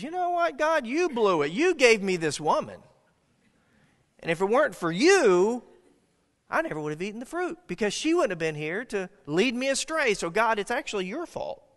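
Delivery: high, loud, accusing Adam voice; hammed-up whiny excuse to God; Adam's indignant blame.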